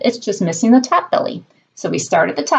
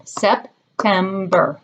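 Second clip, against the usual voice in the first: September said slowly, each syllable separate; Sep, tem, ber, one clap per beat; clapped syllables; much slower than usual. Shown as a demonstration. Teacher leading a clapping game, slow syllables. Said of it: "Let's clap one more time".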